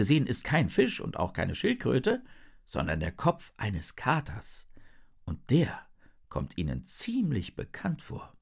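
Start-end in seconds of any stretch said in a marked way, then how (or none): none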